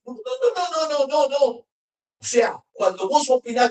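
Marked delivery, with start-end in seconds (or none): 0.0-1.5 high-pitched string of no's; emphatic refusal. Acted. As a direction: cut off the opinion with high, insistent no's. Rejects an imagined believer's opinion. (negativa tajante, en tono agudo)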